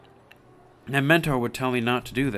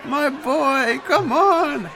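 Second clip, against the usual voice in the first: much higher, louder pleading yell; desperate shout; 9 dB louder, much slower than usual. Acted. Mentor shouts at Schlepp to answer, high and loud. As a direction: cry out to him in despair. Begging the silenced friend.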